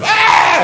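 put-on very high, loud toddler shriek; imitated toddler scream; wild protest scream. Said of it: "wild protest of".

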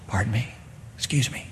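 very soft, polite interjection; hushed, tentative little interruption; still small voice. Acted. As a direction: gently nudge for attention, barely audible. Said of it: "It's a still small voice".

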